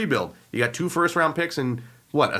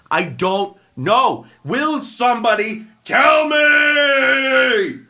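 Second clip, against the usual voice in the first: slow, loud, higher pleading shout; exasperated rant; 8 semitones higher, 6 dB louder, much slower than usual. Real, not acted. Ranting podcaster begging someone to explain, loud and slow. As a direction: throw your hands up and demand answers. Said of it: "what the hell is John Gruden doing".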